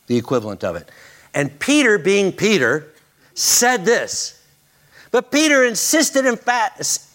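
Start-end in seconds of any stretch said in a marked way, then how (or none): 1.3-7.1 preaching build. Acted.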